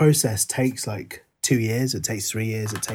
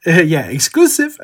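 laughing; 6 semitones higher, 8 dB louder than usual. Real, not acted. Laughing after burp joke.

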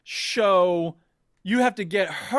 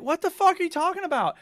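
high-pitched incredulous retort; retold bafflement; 8 semitones higher, faster than usual. Player answering the friend's limelight accusation, high incredulous protest.

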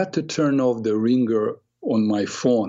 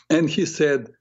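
usual speaking voice

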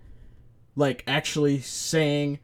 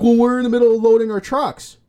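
high, loud, huffy complaint; indignant driver; 8 semitones higher, 7 dB louder than usual. Retold or imitated. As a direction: scold like an annoyed worker. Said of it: "He's like".

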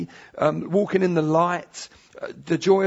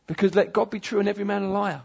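normal talking voice

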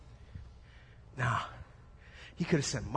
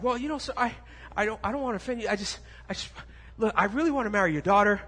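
a hint of a nervous, pleading young prince, higher; timid prince; 6 semitones higher, 5 dB louder, faster than usual. Prince stammering to the father, nervous and higher. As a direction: prince timidly asking the dad for his daughter, nervous.

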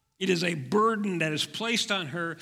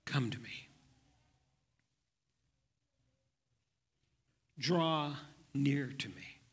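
soft, tender invitation, much quieter; hushed and loving, lower and softer; 4 semitones lower, 4 dB quieter than usual. Acted. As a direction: speak it gently, like drawing someone close. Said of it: "Jesus says in a very loving, soft voice".

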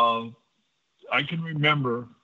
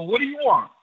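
startled question; 10 semitones higher, 4 dB louder than usual. Retold or imitated. Woken by a knock at 1:30 am.

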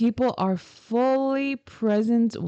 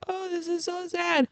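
high, soft, whiny voice as if about to cry; mock tearful whimper, much higher and softer; mock weepy self; 10 semitones higher, 5 dB quieter than usual. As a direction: play yourself choking up at a sad movie. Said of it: "I'm like".

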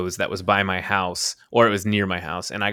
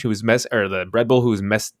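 usual speaking voice

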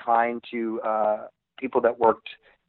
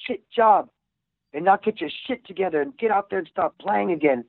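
high, fast mocking Australian boss; mock Australian accent; 8 semitones higher than usual. Dispatcher taunting the band guys, mock Australian accent. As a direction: taunt them in a put-on Australian voice. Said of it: "And he'd go".

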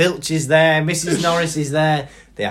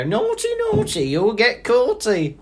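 Peeves voice; 9 semitones higher than usual. Retold or imitated. Peeves teasing students caught out of bed, sing-song. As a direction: tease like a gleeful ghost. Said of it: "you know".

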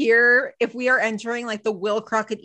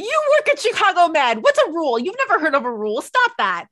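higher, louder playful scolding; mock scolding; 6 semitones higher, 4 dB louder than usual. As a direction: tease him like a scolding friend.

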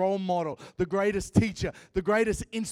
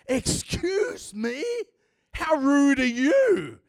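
offended indignation; 5 semitones higher, slower than usual. Retold or imitated. Offended churchgoer answers God.

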